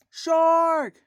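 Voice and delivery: high, loud cry of alarm; panicked scream. Retold or imitated. Beachgoers crying out as the shark appears, panicked shout. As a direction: yell the warning like a terrified swimmer. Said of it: "everyone starts screaming".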